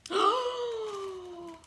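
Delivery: high, long, falling gasped oh; mock dismay. Reader reacts to picture for child, mock dismay. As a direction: draw out a high storybook gasp.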